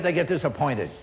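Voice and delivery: ordinary talk, nothing marked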